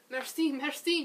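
high, loud, happy thanks; happy and panting. A narrator's reading. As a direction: gush out thanks while catching breath. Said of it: "panting happily".

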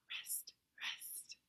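unvoiced whisper for the rests. Shown as a demonstration. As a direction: whisper the silent beats. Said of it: "a new pattern".

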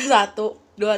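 counting out loud and strong. Real, not acted. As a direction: call the count loudly. Counting loudly while eating.

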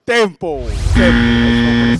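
loud, sharp call to stop; shouting time. Real, not acted. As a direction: yell time across the room. Host calling time.

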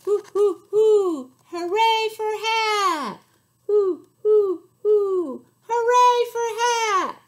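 high, loud hooting cheer; owl cheer. Acted. Owl and friends cheering, loud owl hoots. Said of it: "they all cheered".

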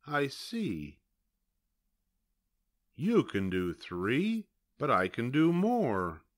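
usual speaking voice

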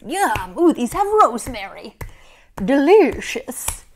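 slow, loud savoring; mock yummy shark. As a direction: relish every sound.

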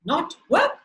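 put-on storyteller's mildly astonished boy; exclaiming. A narrator's reading. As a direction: query it in surprise. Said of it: "Ben exclaimed".

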